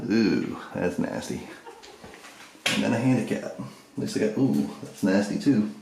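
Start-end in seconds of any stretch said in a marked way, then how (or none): 0.1-1.5 drawn-out, grossed-out "ooh"; disgusted. Real, not acted. Touring a dirty latrine for viewers, disgusted. 4.4-5.7 slow, drawn-out "ooh" of revulsion; grossed out all over again; disgusted. Real, not acted. Showing viewers another filthy stall, disgusted. Reacting to the second dirty latrine stall.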